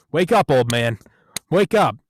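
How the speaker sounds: loud, rising shouts to wake; loud wake-up call. Retold or imitated. Handlers rousing Colbert.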